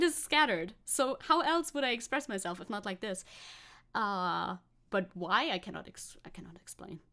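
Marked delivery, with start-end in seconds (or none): 5.5-7.0 I cannot explain breathed out with no voice; whisper. Pretend. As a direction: whisper it, a mock secret. Playful dramatic refusal, whispered.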